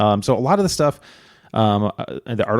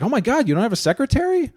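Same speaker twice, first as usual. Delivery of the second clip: flustered excited; 14 semitones higher than usual. Retold or imitated. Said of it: "she was just flustered".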